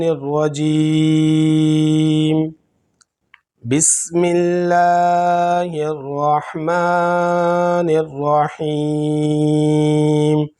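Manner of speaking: very slow, drawn-out tajweed recitation; slow, reverent Quran recitation; Quranic recitation. Acted. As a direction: recite each phrase long and measured. Quranic recitation before lesson.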